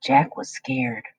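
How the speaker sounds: ordinary delivery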